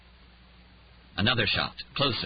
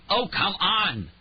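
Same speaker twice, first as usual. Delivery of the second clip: high, slow, exasperated cry; 12 semitones higher, 3 dB louder, much slower than usual. A narrator's reading. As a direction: plead angrily with the machine. Exasperated at computer.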